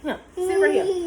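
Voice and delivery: high, loud laughing instruction. Real, not acted. Talking to child while laughing.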